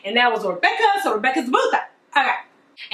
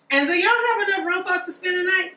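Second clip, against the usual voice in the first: higher, coaxing servant; high pleading servant voice; 8 semitones higher, faster than usual. Acted. Servant asking Rebecca for room, high and coaxing. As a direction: ask sweetly in a high voice.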